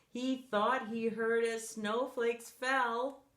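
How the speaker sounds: plain reading voice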